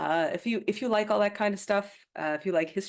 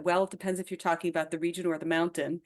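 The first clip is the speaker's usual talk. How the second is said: ordinary delivery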